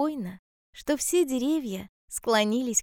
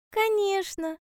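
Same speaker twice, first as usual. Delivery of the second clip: high-pitched little hedgehog; 9 semitones higher than usual. A narrator's reading. Hedgehog assuring bear cub, high hedgehog voice. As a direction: answer high, as a storybook hedgehog.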